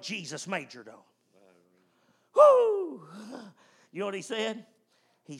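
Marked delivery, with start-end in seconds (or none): 2.3-3.0 high loud woo; preacher's whoop. Real, not acted. Preacher to congregation, high excited whoop. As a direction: let out a joyful whoop.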